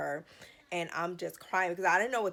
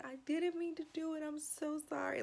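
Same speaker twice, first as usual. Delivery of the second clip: much higher, softer apologetic sob-voice; tearful pleading; 10 semitones higher, 4 dB quieter than usual. Retold or imitated. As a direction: beg forgiveness through tears. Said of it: "I just kept pleading".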